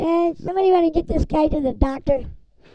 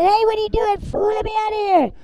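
higher, shouted plea to be let out; yelling from the box; 7 semitones higher than usual. Retold or imitated. Baby trapped in the box yells at the trash guy, mock shout. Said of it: "I said".

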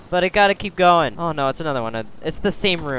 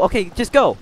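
urgent shouting; 5 semitones higher, 8 dB louder than usual. Real, not acted. Urging partner to run.

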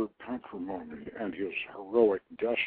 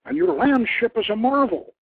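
admiring king; 11 semitones higher, 9 dB louder than usual. Acted. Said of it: "Daniel declared".

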